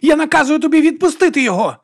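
high, loud order; commanding shout. A narrator's reading. Ordering Viga to release the man, commanding shout. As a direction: command it at full pitch.

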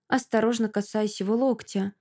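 ordinary delivery

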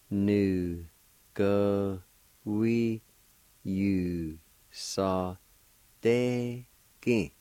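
"Nu-ga-wi-yu-sa-de-gi" drawn out very slowly; very slow syllables. Shown as a demonstration. Teacher slowly modelling the Cherokee word for learners. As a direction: stretch "Nu-ga-wi-yu-sa-de-gi" syllable by syllable. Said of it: "it's pronounced like this".

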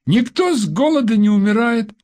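put-on high, merry boy's voice; cheeky child. A narrator's reading. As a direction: joke like a carefree kid. Child joking on phone. (весёлый детский голос)

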